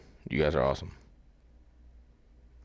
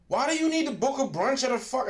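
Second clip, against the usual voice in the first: exasperated; 12 semitones higher, 6 dB louder than usual. Real, not acted. Exasperated rant about brunch.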